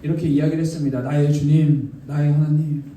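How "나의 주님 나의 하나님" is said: low, measured, acted devotion; mildly tearful confession. Thomas confessing faith to Jesus, mildly tearful. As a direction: confess it in a lowered voice. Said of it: "눈물을 흘리며".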